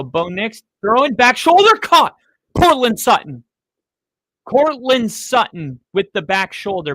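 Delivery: high, loud, thrilled play-by-play; excited play call. Real, not acted. Fan calls Sutton's big catch to viewers, loud and high. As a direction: call the catch like a hyped announcer.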